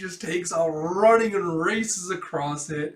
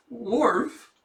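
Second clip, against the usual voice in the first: embarrassed woof; 8 semitones higher, 6 dB louder than usual. Acted. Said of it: "Bond all embarrassed".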